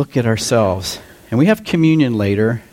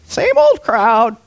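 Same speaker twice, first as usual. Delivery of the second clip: high, slow, louder parrot squawk; 11 semitones higher, 4 dB louder, slower than usual. Acted. Parrot heckling the congregation from the rafters, high slow squawk. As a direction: squawk it down at the crowd. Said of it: "and he goes".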